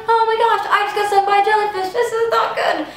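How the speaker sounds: high, fast mock panic; retelling panic after the jellyfish sting; panicked. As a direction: rush it out in a fluster. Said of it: "I was like".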